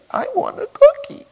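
high, loud mock toddler wail; crying toddler. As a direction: imitate a tearful two-year-old's demand. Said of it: "Why are you crying?".

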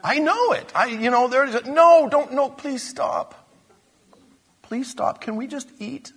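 high-pitched, comic begging to stop; impatient pleading. Acted. Dinner guests begging Jesus to stop talking, impatient plea. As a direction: plead like guests desperate for the speech to end. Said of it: "they just can't wait for him to stop talking".

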